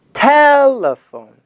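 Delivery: slow, clear Telephone; slow model word. Shown as a demonstration. Teacher to class, slow model word. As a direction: draw it out for learners.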